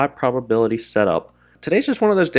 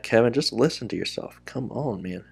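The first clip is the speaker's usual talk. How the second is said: much softer, muttered to himself; quiet self-scolding; 10 dB quieter than usual. Real, not acted. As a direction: scold yourself under your breath. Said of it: "Very frustrated with that".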